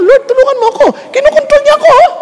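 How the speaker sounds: high, pleading cry to the Lord; pleading prayer. Retold or imitated. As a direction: beg the Lord in a high, helpless voice. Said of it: "Kaya sabi mo".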